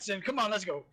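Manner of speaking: usual speaking voice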